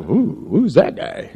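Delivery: soft, slow, drawn-out ooh of distaste; mock disgusted aside. Townsfolk recoiling from fishy Jonah, soft disgusted ooh. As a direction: recoil at the smell, hushed. People react to fish smell.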